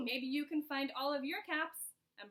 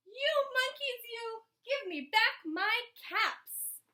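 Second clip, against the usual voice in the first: mad peddler; 8 semitones higher, 5 dB louder than usual. A narrator's reading. Mad peddler shaking both hands at monkeys, loud scolding. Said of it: "This made the peddler feel mad".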